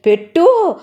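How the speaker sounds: very high, loud one-word command; wife demanding her husband feed her; bossy order. Acted. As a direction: snap the order at him.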